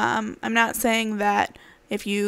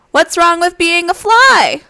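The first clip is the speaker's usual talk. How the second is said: indignant; 7 semitones higher, 11 dB louder, slower than usual. Acted. Fly indignant.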